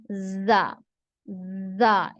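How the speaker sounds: "जा" drawn out slowly with a hiss; slow hissing "जा" as a model; letter demo. Shown as a demonstration. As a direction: model "जा" with a whistling sound. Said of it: "सीटी की आवाज आपकी आनी चाहिए".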